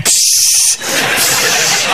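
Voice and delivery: sharp unvoiced hiss to shoo a rat; hissing psst. Staff shooing the rat.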